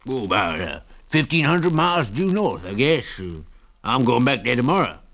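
put-on wheezy old prospector voice; wheezy old man. A narrator's reading. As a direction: rasp it out like a wheezy old miner. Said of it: "in a wheezy voice".

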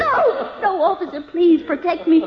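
high, tearful begging; frightened appeal, pitch rising; desperate plea. Acted. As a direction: beg an officer for protection. Wife begs policeman for protection.

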